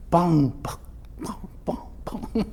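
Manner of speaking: pam imitando batidas na porta; batidas imitadas. Retold or imitated. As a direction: som de batidas, lento e marcado. (filho ouve batidas na porta, imitadas)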